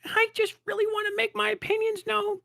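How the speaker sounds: put-on very high, airy young voice; mock an earnest nineteen-year-old; naive teenager. Mocking a naive young creator, very high voice.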